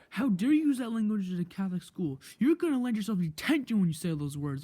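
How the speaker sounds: loud, scolding teacher impression; imitated yell. Angry teacher threatening a student with detention, mock yell. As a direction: mimic an outraged teacher telling someone off. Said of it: "full out just yells".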